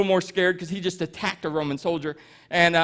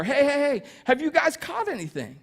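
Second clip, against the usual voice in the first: high-pitched calling out, no real volume; imitated long-distance holler, pitched up; mock yell; 6 semitones higher than usual. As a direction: call from the shore in a mild mock yell. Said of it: "he yells from the shore".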